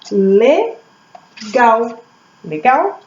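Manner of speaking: "Legal" as Le, long pause, gal; syllable by syllable. Shown as a demonstration. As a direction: break "Legal" into two isolated beats. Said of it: "Duas vezes".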